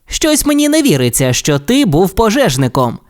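high, doubtful race car voice; disbelieving. Acted. Race car doubts his friend's story, high and disbelieving. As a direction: scoff like a cocky race car. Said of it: "Блискавка Маквін".

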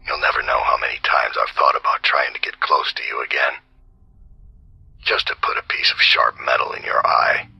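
a hard, breathy whisper pushed with force; harsh whisper. Acted. Assassin whispering his contempt over the Regent, harsh whisper. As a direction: hiss the whole taunt out in a tight whisper. Same lines again as a whispered take.

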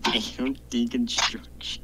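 breathy whispered echo; whispering. Real, not acted. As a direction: whisper it back in disbelief. Repeating answer whispered.

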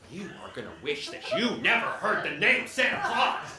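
loud gleeful threat; mock threat. Elf scaring the boy about Santa, loud and gleeful. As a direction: bellow the doom at the kid.

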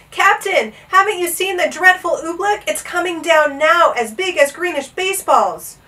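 loud, high frantic warning; urgent alarm. A narrator's reading. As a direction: press the danger on them. Frantically warning an unworried captain.

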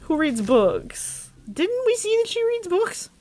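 squeaky mock astonishment; high squeak. Real, not acted. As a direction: pitch it up in disbelief. High incredulous question.

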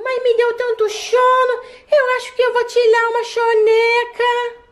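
put-on little kid voice. Child voice, sleepy after popsicle.

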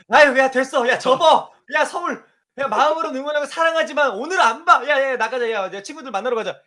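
loud, exasperated giving up; fed-up fan. Retold or imitated. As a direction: yell like a fan who's done watching. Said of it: "저 같아도".

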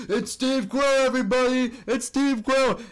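high announcing call; announcer hype. Retold or imitated. Scam artist announcing himself at the door, high hyped call. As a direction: announce yourself up high like a showman. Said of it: "the Steve Quell says".

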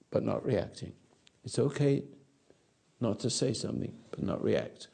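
normal talking voice

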